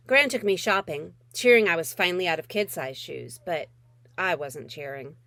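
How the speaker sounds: usual speaking voice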